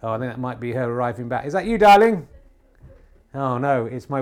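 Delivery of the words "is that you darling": loud, high call to another room. Real, not acted. Host calls out to his wife arriving home, loud high call.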